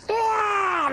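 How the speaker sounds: high, loud lunging shriek; goblin's high attack scream; monster screech. Acted. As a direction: shriek as he lurches forward. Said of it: "this one's just like".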